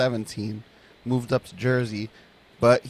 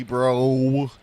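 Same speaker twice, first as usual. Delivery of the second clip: put-on a quick Miami accent on bro; hint of a Miami accent; 3 dB louder than usual. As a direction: toss off bro like a Miami local. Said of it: "Miami accent".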